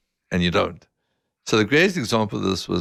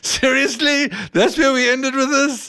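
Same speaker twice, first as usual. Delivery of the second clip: high, loud, slow incredulous protest; mock exasperation; 5 dB louder, slower than usual. Dad re-enacting his reaction to the backfire, mock exasperation. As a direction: protest in drawn-out, high-pitched disbelief.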